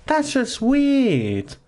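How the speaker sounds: high, very slow put-on squeak; mock high voice. Streamer mocking a weird name in a silly high, slow voice.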